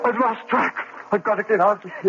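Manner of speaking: frantic, racing voice; panic. Acted. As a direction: bolt for the door in terror. Panicking man.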